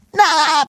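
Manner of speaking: high, short goofy giggle; rippling cartoon chuckle; laugh at own pun.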